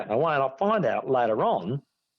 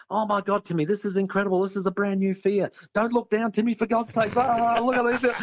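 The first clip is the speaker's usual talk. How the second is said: high, babbling mock excitement; lightly voiced chatterbox; nonstop excited chatter; 8 semitones higher than usual. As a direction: imitate a mate who won't shut up. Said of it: "He's going".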